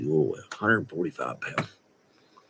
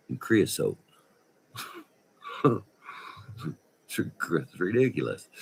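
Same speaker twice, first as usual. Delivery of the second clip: ordinary talk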